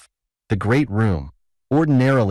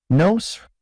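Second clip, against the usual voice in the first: high-pitched exclamation, moderately raised voice; emphatic shout; 10 semitones higher than usual. A narrator's reading. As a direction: jump up in pitch to flatly contradict. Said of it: "the old man shouted emphatically".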